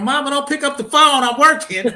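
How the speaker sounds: high, loud mock yell; screaming at mama. Son begging mama to stay off the line, screaming. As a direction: holler through the house in exasperation. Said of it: "I'd be screaming".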